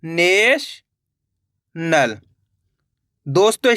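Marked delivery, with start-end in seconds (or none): 0.0-2.2 national drawn out very slowly, syllables joined; slow teacher blending; slow blended word. Shown as a demonstration. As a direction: stretch national out as the letters combine. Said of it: "तो मिलके बन गया".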